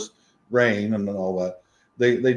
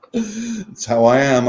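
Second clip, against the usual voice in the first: laughing; 5 semitones higher, slower than usual. Real, not acted. Laughing at himself.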